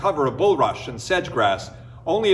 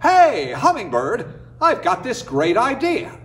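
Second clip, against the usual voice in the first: excited idea; 8 semitones higher, 4 dB louder than usual. Acted. Coyote calls to hummingbird.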